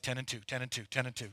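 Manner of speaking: low, hushed self-reminder chant; nervous muttering. Retold or imitated. Young pastor steadying himself while driving his idol, nervous muttering. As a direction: mutter it under your breath, very softly.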